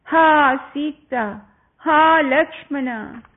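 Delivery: high, loud cry for help stretched out; loud desperate call. Acted. Dying demon calls out to Sita and Lakshmana in Rama's voice, a loud desperate cry. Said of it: "Imitating Rama's voice perfectly, he called out loudly".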